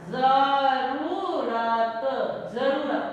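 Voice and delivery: "जरूरत" stretched very slowly with a clear z; slow z demo. Shown as a demonstration. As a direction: draw out "जरूरत" slowly, z sound. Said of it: "जरूरत होता है". ("जरूरत" बहुत धीरे, साफ़ ज़ के साथ)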